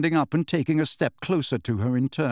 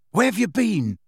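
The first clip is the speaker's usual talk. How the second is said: high, insistent question; demanding; 8 semitones higher, 3 dB louder than usual. A narrator's reading. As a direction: demand an answer from a friend. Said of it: "demanded Ron".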